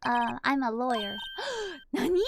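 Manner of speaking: normal talking voice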